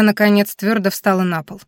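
ordinary delivery, nothing marked

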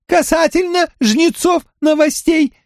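very high monotone alien voice; high, flat and mechanical, like a crab creature. Acted.

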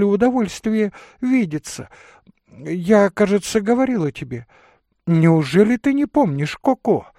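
high, loud, agitated lady telling her mishap; voluble excitable lady. Acted. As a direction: pour out a dramatic excuse. (высокий взволнованный дамский голос)

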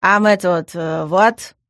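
halting, awkward explanation; embarrassed. A narrator's reading. Gas explains himself to the room's owner, embarrassed. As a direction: stumble sheepishly for words. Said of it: "смущённо произнёс Газ".

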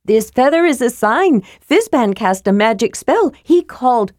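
high-pitched, breathless kender chatter; excited shouting. A narrator's reading. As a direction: gush out the news eagerly. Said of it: "Tass shouted".

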